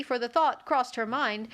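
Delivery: plain reading voice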